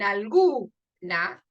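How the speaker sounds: "alguna" drawn out slowly on GU; stress on the middle syllable. Shown as a demonstration. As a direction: hold the GU in "alguna", then drop NA. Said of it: "se pronuncia con más fuerza". ("alguna" con la sílaba GU alargada y marcada)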